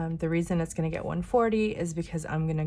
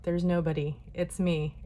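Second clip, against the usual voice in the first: usual speaking voice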